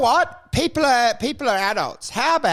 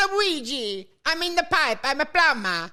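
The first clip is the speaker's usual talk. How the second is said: high, sing-song cartoon Italian accent; ice cream seller Italian; 7 semitones higher than usual. Comic doing the ice cream seller, cartoon Italian accent. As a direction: ham up a cutesy Italian accent. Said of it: "The ice cream seller".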